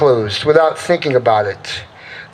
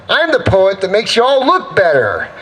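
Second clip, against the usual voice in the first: ordinary delivery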